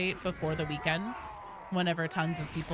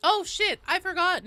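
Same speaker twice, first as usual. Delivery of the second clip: high, fussy granny voice; a startled old woman; old lady voice; 13 semitones higher, 7 dB louder than usual. Acted. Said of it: "supposed to be doing the old la-, lady voice".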